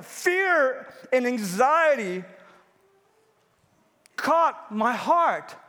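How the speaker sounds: very slow, weighty words; slow and heavy. Acted. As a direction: let the fear sink in slowly. Sermon climax, drawn out.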